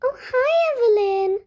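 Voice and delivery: bright fake hello to a rival; fake nice. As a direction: put on a fake friendly voice. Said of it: "Act nice".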